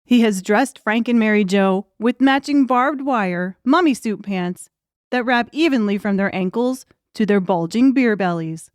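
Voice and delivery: normal talking voice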